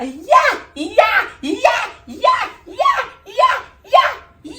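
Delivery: very high shrieked yelps; mock shrill screaming; tiny screamer's yelps. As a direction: squeal repeatedly like a small screaming potato. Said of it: "une petite patate qui crie".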